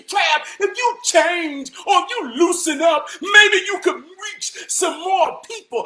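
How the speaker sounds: high, loud mocking imitation; mock advice-giver. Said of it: "they say".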